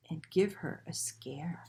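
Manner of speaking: whispered plotting; sneaky hushed whisper; conspiratorial whisper. A narrator's reading. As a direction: whisper it like a plan. Ghosts plot a scare.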